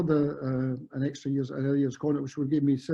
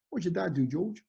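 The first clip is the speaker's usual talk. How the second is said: higher-pitched, attempted Cockney accent; Frank Lampard; 4 semitones higher than usual. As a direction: have a rough go at Cockney. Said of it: "I'm not good at Cockney accent".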